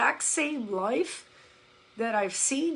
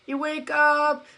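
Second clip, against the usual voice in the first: ordinary talk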